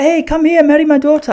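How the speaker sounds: high, loud mock old woman calling out; calling older woman. Older woman to the tourist, high loud mock matchmaker call.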